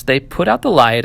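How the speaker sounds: usual speaking voice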